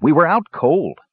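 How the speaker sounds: plain reading voice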